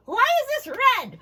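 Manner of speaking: high, loud eager puppet; a puppet asking to borrow the mask. Acted. Puppet asks teacher.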